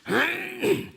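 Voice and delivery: sharp high throat clearing. Retold or imitated. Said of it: "in my gravelly voice".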